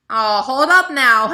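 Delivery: slow, loud hold-up-now balk; mock alarm. Refusing to teach beside her upline, loud mock alarm. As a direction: put the brakes on in disbelief. Balking at being asked to teach.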